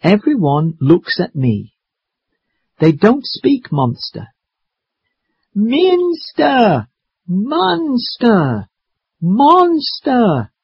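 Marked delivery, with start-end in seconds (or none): 5.5-10.5 long, high-pitched drawn-out calls; yelling. Acted. Maddie calls out as everyone looks, drawn-out yell. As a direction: stretch each word into a high call. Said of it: "I yell very loudly".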